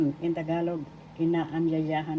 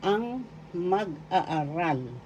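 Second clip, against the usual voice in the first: "ang mag-aaral" drawn out slowly with a long aa; slow teaching; much slower than usual. Shown as a demonstration. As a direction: spell it out slowly for learners. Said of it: "In Tagalog".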